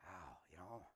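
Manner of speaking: breathy whispered wow; awed whisper. Real, not acted. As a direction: drop to a whisper in awe. Awed aside after the shout.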